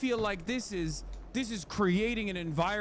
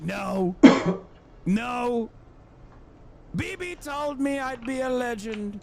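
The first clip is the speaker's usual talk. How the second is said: Trump impression; 4 semitones higher, 5 dB louder, slower than usual. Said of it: "Trump's like".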